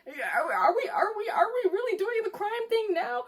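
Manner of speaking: incredulous. Acted. Incredulous rant about double standard.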